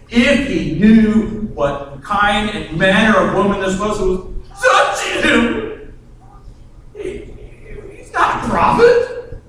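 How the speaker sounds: slow, sneering disbelief; a hint of a sniffy Pharisee; scoffing Pharisee. Simon the Pharisee sneers about Jesus and the woman, scoffing.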